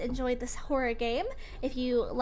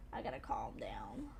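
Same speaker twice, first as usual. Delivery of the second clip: breathy whisper to herself, much lower; soft self-soothing whisper; 9 dB quieter than usual. Real, not acted. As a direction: whisper it to calm yourself down. Calming herself after scary game.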